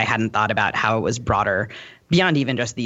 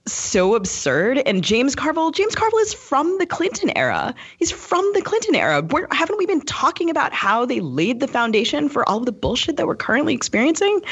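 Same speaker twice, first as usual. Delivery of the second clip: higher, rising exasperation at the pundits; exasperated rant; 12 semitones higher than usual. Real, not acted. Podcast guest venting about the pundits, exasperated. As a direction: let the frustration pitch the voice up. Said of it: "very little patience for this stuff today".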